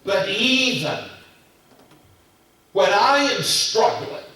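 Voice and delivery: loud, high, rising preacher's cadence; preaching at full force. Acted. Pastor confessing doubts to the church, in full preaching voice.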